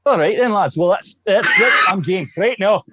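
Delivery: high, loud hammed-up Scottish brogue; a mock Scottish accent to tease the hosts. Guest hamming up a Scottish accent for the Scottish hosts. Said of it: "I can give you a real deep Sean Connery".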